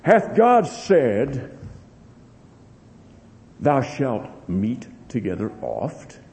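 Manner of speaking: slow, drawn-out serpent voice; sly serpent. Acted. Preacher voicing the serpent tempting the congregation, slow. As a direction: stretch each word to tempt.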